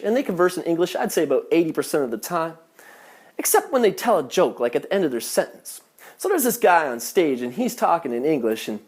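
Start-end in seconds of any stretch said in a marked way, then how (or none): none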